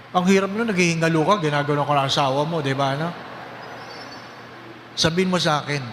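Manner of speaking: ordinary talk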